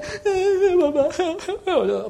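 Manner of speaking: high, loud wailing cry of papa; mock child wail. Child crying out for Papa, high mock wail. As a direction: wail like a frightened little child.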